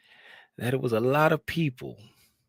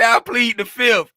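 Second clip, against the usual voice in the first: quoting [name]; 7 semitones higher, 8 dB louder than usual. Retold or imitated. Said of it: "[name] say".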